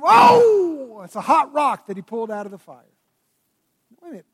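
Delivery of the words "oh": very loud, high, drawn-out howl; mock pained yell. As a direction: howl as if sitting on a hot rock. Acts Peter sitting on hot rock.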